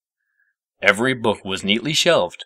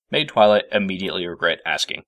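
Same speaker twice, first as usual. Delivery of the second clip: ordinary delivery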